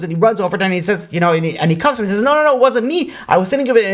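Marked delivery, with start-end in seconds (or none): 2.2-3.9 high-pitched retold denial; acting out a frantic suspect; panicked protest. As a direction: plead innocence in a raised, urgent voice.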